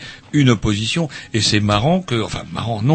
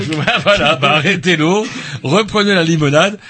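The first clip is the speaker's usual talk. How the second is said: laughing; 7 semitones higher, 5 dB louder than usual. Pretend. Joking scolding amid laughter.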